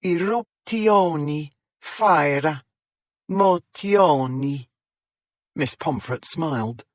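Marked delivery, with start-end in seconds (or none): 0.0-4.7 put-on slow, higher Italian accent with extra end vowels; extremely Italian accent. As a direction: linger on each word like a comic Italian. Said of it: "her extremely Italian accent".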